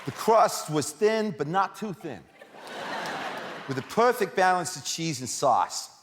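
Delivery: reading a pizza review in a hammed-up Brooklyn accent; slow, laughing Brooklyn voice. Shown as a demonstration. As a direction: put on a Brooklyn pizza-joint voice. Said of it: "attempt to read it with a Brooklyn accent".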